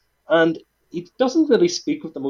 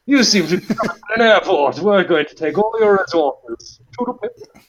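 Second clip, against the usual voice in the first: higher, louder, pompous upper-class English officer voice; mock posh colonial Brit; 5 semitones higher, 5 dB louder than usual. Podcast host mocking the British Empire, in a pompous posh voice. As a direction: ham up a haughty imperial Englishman.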